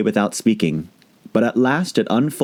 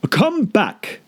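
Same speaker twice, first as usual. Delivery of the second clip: calling after; 13 semitones higher than usual. A narrator's reading. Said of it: "the caterpillar called after her".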